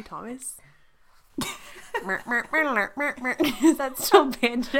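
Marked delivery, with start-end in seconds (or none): 1.5-3.7 high mock trumpet noises through laughter; trumpet impersonation. Shown as a demonstration. Showing the co-host a best trumpet, comic mouth-made toots. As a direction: toot a silly tune like a trumpet. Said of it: "my best trumpet impersonation".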